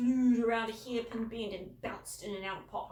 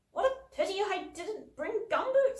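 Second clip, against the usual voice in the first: false regret; 11 semitones higher, 7 dB louder than usual. A narrator's reading. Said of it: "said Mum with false regret".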